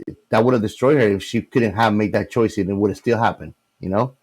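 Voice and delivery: usual speaking voice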